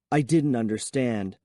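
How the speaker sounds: ordinary delivery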